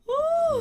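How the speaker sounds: sharp gasp, then a high "ooh"; shocked gasp. Real, not acted. Host hearing the singer lost his voice, shocked gasp and high ooh. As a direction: gasp and coo high in sympathy. Host reacts to month-long voice loss.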